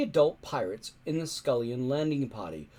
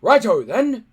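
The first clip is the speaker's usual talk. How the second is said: much higher, louder barked orders; booming chief; 9 semitones higher, 12 dB louder than usual. A narrator's reading. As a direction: bellow like a big Viking chief. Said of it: "said Stoic".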